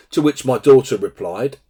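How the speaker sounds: normal talking voice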